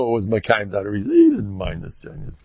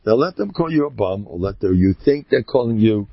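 ordinary talk, nothing marked

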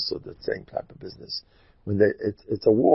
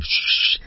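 voiceless shushing noise; imitated whispering. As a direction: mimic a secret whisper in the ear. Whispering assistant imitated again.

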